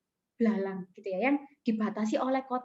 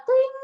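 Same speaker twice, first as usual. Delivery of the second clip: loud, high-pitched leap sound effect; high springing "twing"; 13 semitones higher, 7 dB louder than usual. Retold or imitated. Storyteller imitating the grasshopper's jump, high springy noise. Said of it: "Dia lompat".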